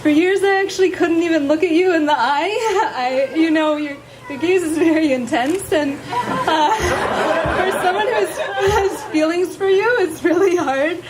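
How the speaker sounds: nervous laughing vows; shy, giggling through her vows; saying wedding vows. Real, not acted. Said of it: "would you please share your vows".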